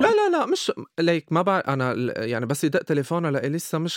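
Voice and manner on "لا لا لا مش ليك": urgent denial. Real, not acted. Quick denial of hidden blame.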